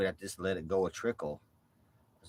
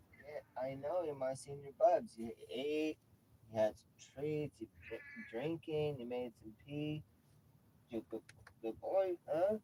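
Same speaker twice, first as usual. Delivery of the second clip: pet talk; 7 semitones higher than usual. Real, not acted. Praising senior cat.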